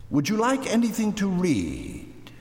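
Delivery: high, sweetly polite lady's voice; polite aunt. A narrator's reading. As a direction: offer it politely, high and lilting. Aunt politely addresses the firemen.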